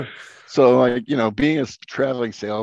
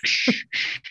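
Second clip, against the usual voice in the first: hushing. Retold or imitated. Hushing as the peddler.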